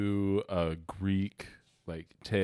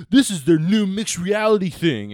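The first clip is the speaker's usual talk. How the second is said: loud, high salesy pitch voice; mocking marketing hype; 9 semitones higher, 10 dB louder than usual. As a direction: ham up an ad announcement. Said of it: "the marketing people for just being like".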